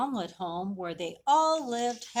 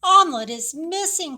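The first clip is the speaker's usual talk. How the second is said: high, loud worried cry; alarmed cry; 8 semitones higher, 11 dB louder than usual. A narrator's reading. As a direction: call out in panic. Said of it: "cried the little lamb".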